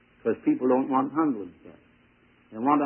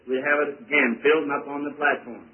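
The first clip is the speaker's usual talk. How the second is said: ordinary talk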